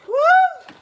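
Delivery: sudden high-pitched "whoo!"; high loud whoop. Real, not acted. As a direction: let out a big whoop. Exclaims before reading comments.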